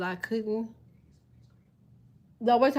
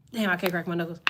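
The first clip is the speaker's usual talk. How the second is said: ordinary talk, nothing marked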